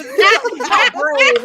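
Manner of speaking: high loud mimic of Mandy; mocking quote. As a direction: squeal it back teasingly. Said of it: "Y'all are brave".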